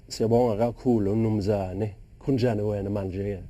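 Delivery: "Siyabonga kakhulu, Mnumzane" said slowly in Swazi; "Kunjani wena manje" in Swazi with clicks; spoken Swazi. Shown as a demonstration. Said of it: "Can you do a sentence for us then".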